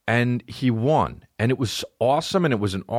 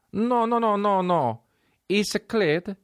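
put-on Italian accent; 12 semitones higher, slower than usual. Italian voice in quoted dialogue.

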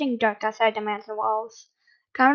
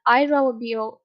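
ordinary delivery

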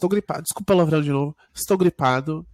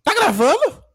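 sudden high, loud disbelief; shrill panic that the mic is on; alarmed squeak; 11 semitones higher, 7 dB louder, slower than usual. Real, not acted. Co-host realizing it's recording, alarmed squeak.

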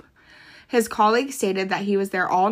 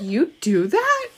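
amazed stepdad; 7 semitones higher, 6 dB louder, slower than usual. Retold or imitated. Stepdad amazed at Googling people.

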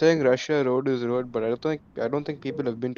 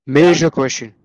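louder announcer-style echo; dramatic echo; 9 dB louder than usual. Pretend. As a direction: echo it like a hype man. Echoing host dramatically.

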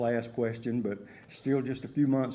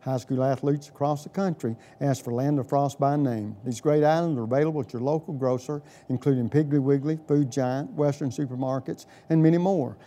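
upbeat, louder radio ad read; chipper 1950s ad; 3 semitones higher, 8 dB louder than usual. Acted. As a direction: sell it like a retro radio spot. Host reads sponsor ad.

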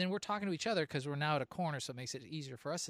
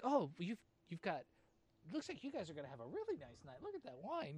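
higher, softer, sing-song imitation of a friendly lady; lightly voicing a chatty southern lady in the checkout line; chatty friendly stranger; 4 semitones higher, 5 dB quieter than usual.

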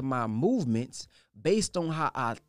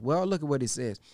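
usual speaking voice

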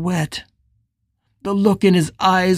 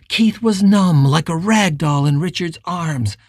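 plain reading voice

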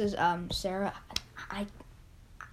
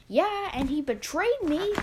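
higher, louder aggrieved retort; bitter retort; 6 semitones higher, 4 dB louder than usual. Acted. Petey answers his kid about Grandpa's betrayal, bitter. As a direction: fire back as the wronged one.

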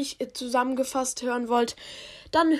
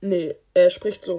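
lower, louder character voice; Ash voice; 5 semitones lower, 4 dB louder than usual. Shown as a demonstration. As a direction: drop the voice low and push. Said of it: "meinte ich".

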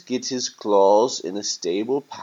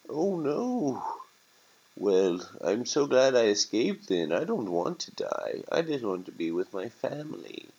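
lightly voiced fretful bird; distressed naive turkey; 4 semitones higher than usual.

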